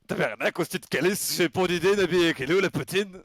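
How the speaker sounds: swearing in a put-on Québécois twang; Québécois accent. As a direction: caricature Quebec French. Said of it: "l'accent québécois".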